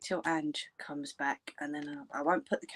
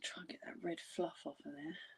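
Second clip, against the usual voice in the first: whispered to herself; 14 dB quieter than usual. Real, not acted. Muttering to herself while cleaning.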